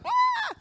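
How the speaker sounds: very high, short mock scream; exaggerated freak-out squeal; mock shriek. Housemate reacts to borrowed cream, mock shriek. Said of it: "they were super intense".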